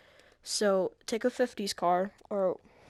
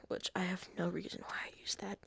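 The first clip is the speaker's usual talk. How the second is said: whispered aside; 8 dB quieter than usual. Real, not acted. Embarrassed aside about own habit.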